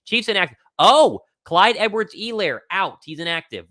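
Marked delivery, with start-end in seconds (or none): 0.8-3.0 surprised. Real, not acted. Reacting to surprise inactive news.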